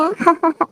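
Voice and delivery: short soft chuckle; light laugh. Acted. Husband teasing his wife about sleep, light laugh. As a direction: laugh lightly at your own joke.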